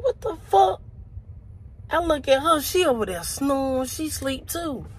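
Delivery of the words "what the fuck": high-pitched, rising bewilderment; startled disbelief. Retold or imitated. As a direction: react to a mystery noise, voice shooting up. Startled by a mystery noise.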